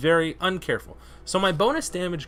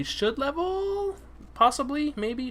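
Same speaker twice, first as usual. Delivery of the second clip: hopeful high; 11 semitones higher, slower than usual. Real, not acted. Hoping to level.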